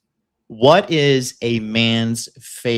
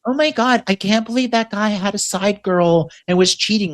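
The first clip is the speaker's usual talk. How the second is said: much higher, gasping mock girl voice; mocking shocked girl; 8 semitones higher than usual. Mocking naive girls to his cohost, high shocked voice. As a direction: parody a girl shocked at cheating. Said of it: "when girls are like".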